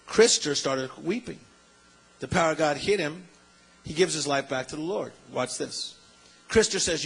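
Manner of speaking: usual speaking voice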